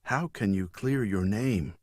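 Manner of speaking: usual speaking voice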